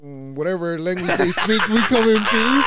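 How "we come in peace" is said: loud mock-robot alien greeting; lightly robotic alien, projected loudly; robot accent. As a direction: announce it like a pretend robot alien. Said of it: "Like a robot accent?".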